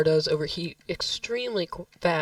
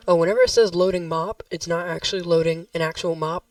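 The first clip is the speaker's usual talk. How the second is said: normal talking voice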